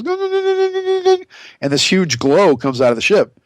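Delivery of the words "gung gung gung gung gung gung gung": high ringing gung gung; imitated bell tones. Mimicking the ship's Tibetan bell sound, high ringing tones. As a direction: sing a bell's ring. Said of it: "this Tibetan bell sound where it goes".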